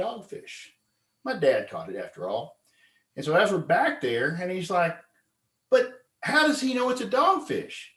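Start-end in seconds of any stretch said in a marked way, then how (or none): 5.7-7.9 doubting friend. Retold or imitated. Gullible Bill doubting.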